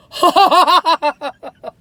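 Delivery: loud, high peal of laughter; delighted laugh. Real, not acted. Delight at the cookie.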